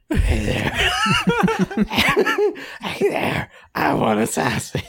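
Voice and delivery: throaty, strained rasp with laughs; hammed-up raspy character, cracking up. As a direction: push a silly rasp out of the throat. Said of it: "then make it raspy".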